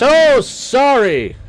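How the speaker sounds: high, loud, drawn-out sorry; startled, stretched-out outburst; loud exclaimed apology. Real, not acted. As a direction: yelp an apology over the noise. Game starts yelling at the player.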